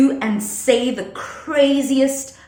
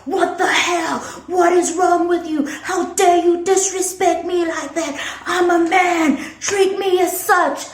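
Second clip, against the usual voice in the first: mock outraged boyfriend, higher pitched; 7 semitones higher than usual. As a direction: parody his indignant rant in a higher voice. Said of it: "And he was like".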